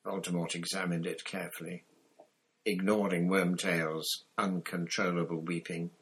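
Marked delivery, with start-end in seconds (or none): none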